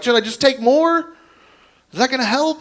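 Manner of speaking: mock-helpless high voice; panicked. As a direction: ask in squeaky bewildered worry. Imagined panicked patient holding pills.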